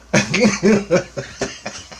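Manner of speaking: bursts into a high laugh at his own story; a short falling laugh, up high. Real, not acted. Telling friends about the dream, high amused laugh.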